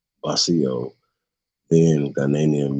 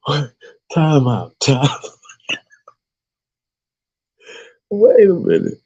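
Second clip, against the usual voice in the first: laughing; 10 semitones higher than usual. Real, not acted. Trying to keep reading, still laughing high. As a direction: fight to talk through laughter.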